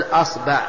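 "أصبع" said as asba', a in both syllables; opening a, middle a. Shown as a demonstration. Teacher lists variants for students, "أصبع" as asba'. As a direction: say "أصبع" with fatha on hamza and ba. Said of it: "أولا افتح الهمزة".

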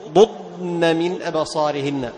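ضضن with the doubled ض fully articulated, slow; slow, emphatic ض in ضضن; clear dad. Shown as a demonstration. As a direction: hold each ض in ضضن distinct, no merging. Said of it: "فتحافظ على إظهار الضاد".

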